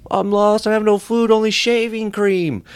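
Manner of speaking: high, loud fake distress; hammed-up lost-in-the-woods wail; mock helpless wanderer. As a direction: call out as if lost, hamming it up. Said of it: "I'll just walk out in the jungle lost".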